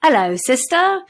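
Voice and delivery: light British cartoon-pig accent; a playful British "Hello, sister" like the cartoon pig; Peppa Pig voice. Mother quoting the cartoon to her daughters, in a light British accent. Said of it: "I guess a pig comes out".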